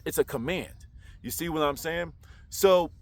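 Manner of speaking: ordinary talk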